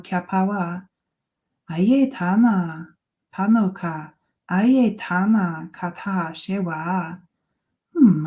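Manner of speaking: chanted invented language, lightly voiced; spoken light language. Channelling Tuta's language to the group, lightly voiced. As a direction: intone the channelled syllables steadily.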